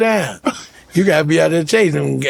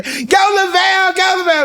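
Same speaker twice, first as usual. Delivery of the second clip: mock cheering shout; 11 semitones higher than usual. Cousin's friends cheering him on at the open mic, high shouted cheer. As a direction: holler it like a fan in the crowd.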